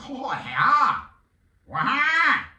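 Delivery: loud, high nonsense holler; goofy yell. Retold or imitated. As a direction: mimic a silly video maker's squawk. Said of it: "makes some goofy sound like".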